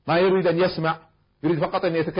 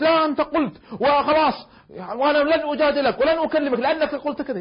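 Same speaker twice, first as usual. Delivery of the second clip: much higher and louder, indignant; stubborn grudge; 13 semitones higher, 7 dB louder than usual. Retold or imitated. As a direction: insist angrily you won't forgive. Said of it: "بعض الناس". (بإصرار غاضب)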